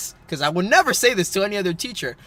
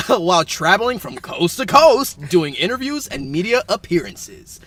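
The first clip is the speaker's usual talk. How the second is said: louder, upbeat newscaster delivery; mock news read; 4 semitones higher, 7 dB louder than usual. Host reads silly job news to co-host, mock newscaster. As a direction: hype it like a news anchor racing a timer.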